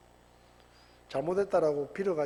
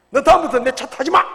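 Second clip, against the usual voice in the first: put-on loud, high scolding of the child; 화난 아빠의 고함; angry yell; 7 semitones higher, 14 dB louder than usual. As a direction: bellow it like a furious parent over spilt milk. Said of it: "막 소리 지르고".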